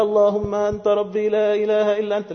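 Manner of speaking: chanted by habit, high held note, loud; rote recitation. Shown as a demonstration. Preacher showing youth how not to say it, rote chant. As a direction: sing it high and loud in one unbroken run. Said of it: "مش عايزكم تقولوها زي ما بنعملها كده".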